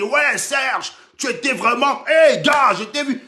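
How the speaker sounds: high, loud mock cheer from a fan; mock fan shouting. Bamileke fan hailing Serge, hammed-up high shout. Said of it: "qui a dit".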